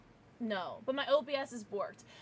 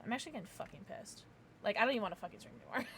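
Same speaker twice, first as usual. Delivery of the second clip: usual speaking voice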